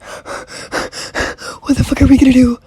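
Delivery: high, loud mock wail; hammed-up tearful panic, drawn out; Piggy panicking. Stranded boy with no adults, wailing panic. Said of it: "And Piggy's like".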